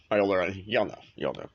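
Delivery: normal talking voice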